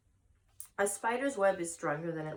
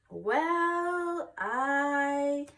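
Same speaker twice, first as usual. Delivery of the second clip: put-on high, drawn-out hesitant girl; a girl stalling while she searches for words; hesitant Fern; 8 semitones higher, 5 dB louder than usual.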